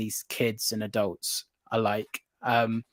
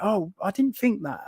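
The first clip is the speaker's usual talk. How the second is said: high-pitched imitation of onlookers; surprised; 9 semitones higher than usual. Onlookers hearing about sulking lizard, surprised. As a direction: voice their mild wonder, pitched up. Retells people's surprised reply.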